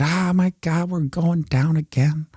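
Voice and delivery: high, drawn-out mock wail of alarm; hammed-up dread of a crash; mock panic. Price crash likened to car crash, mock panic.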